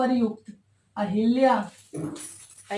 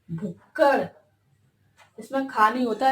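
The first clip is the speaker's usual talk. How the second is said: normal talking voice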